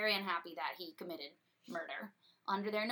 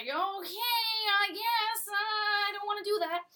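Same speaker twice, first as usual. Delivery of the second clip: reluctant Hubert; 9 semitones higher than usual. Retold or imitated. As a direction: drag it out like a henchman who'd rather not. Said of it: "And Hubert's like".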